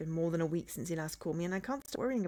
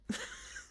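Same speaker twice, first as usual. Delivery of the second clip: high, squeaky suppressed laughter; helpless giggle; 8 dB quieter than usual. Real, not acted. Reader giggles at chick room slip, helpless.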